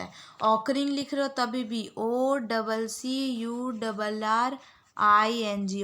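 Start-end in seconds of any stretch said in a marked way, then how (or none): 2.0-5.8 letter by letter, very slow; slow spelling out. Shown as a demonstration. Teacher spelling a word slowly for the class. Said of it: "आप लोग बार बार repeat कर लो".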